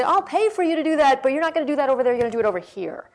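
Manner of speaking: firm and emphatic. Retold or imitated. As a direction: lay down the rule firmly. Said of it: "Sort of say".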